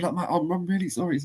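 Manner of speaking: high, hurried pleading; quick mock-apologetic babble; grovelling apology. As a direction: imitate a rushed, grovelling apology. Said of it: "I was all grovelly and apologies".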